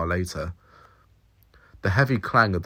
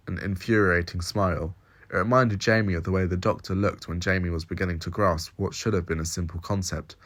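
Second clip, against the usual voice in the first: ordinary delivery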